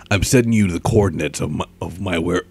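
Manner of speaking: lightly put-on gruff hero voice; Batman. Shown as a demonstration. Vlogger trying a hero line, slightly gruff. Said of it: "I'll try to do a Batman voice".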